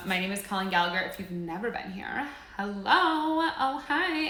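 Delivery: ordinary talk, nothing marked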